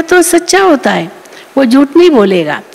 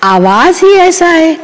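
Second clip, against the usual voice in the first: mock excuse; 6 semitones higher than usual. Mimics loud-voiced excuse maker.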